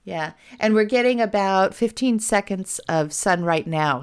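ordinary talk, nothing marked

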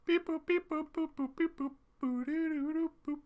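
high robotic beep boop. Acted. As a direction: beep like a computer loading. Robot noises while queuing guest.

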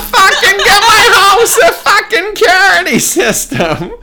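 hammed-up New York accent at full yell; over-the-top, high-pitched bellowing, cracking into laughter; mic-maxing New York shout. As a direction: scream it like a cartoon New Yorker, very high and loud. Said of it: "all the vocal levels just maxed out".

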